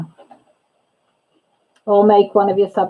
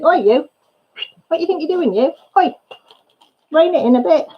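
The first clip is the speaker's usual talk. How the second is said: high, jokey telling-off voice; mock scolding friend; 7 semitones higher than usual. Group member scolding her for saying something out of place, mock stern and high. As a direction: play the friend who reins her in, half teasing.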